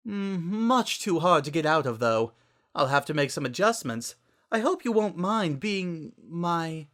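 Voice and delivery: Rarity voice. A narrator's reading. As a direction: gush it out grandly. Rarity character voice.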